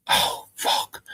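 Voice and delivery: breathless hurt "oh fuck"; voiceless stunned curse; pained groan. Retold or imitated. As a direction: wince it out like you just got slapped. Reacting to being slapped.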